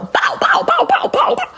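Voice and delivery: very high-pitched "bow" noises; rapid-fire imitated bangs, squeaked way up high. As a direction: make repeated high cartoon bang sounds.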